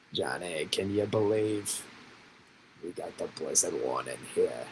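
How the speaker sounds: a Boston weatherman hamming up a storm to Johnny; lightly put-on Boston accent. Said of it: "so much more fun to say things with the Boston accent".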